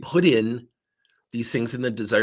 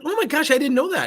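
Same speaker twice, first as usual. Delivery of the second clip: high-pitched, louder retold amazement; amazed; 5 dB louder, faster than usual. Coach learning of his weekend hobby, amazed. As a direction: react with big surprise.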